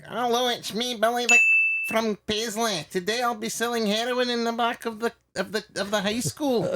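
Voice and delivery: put-on high-pitched kid character voice; Belly from Paisley. Comic character introducing himself as a kid from Paisley, high character voice. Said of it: "I used to do a character".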